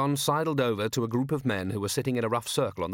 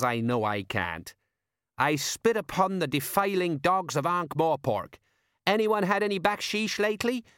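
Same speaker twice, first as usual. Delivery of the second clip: higher, hearty fake local; badly faked desert tribesman, overdoing it; mock Clatchian talk; 6 semitones higher than usual. A narrator's reading. Colon posing as a Clatchian among diners, hammed-up chumminess. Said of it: "Anyone knew how Clatchians talked".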